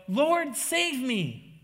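loud, high, drawn-out plea for rescue; desperate cry. Retold or imitated. Sinking Peter begs Jesus for rescue, crying out desperately. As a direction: cry out for help, stretching each word. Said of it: "began to sink and cries out".

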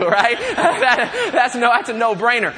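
high, loud laughing admission; amused, voice jumping up with laughter. Real, not acted. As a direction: laugh through the words at your own expense. Laughs at admitting he cries too.

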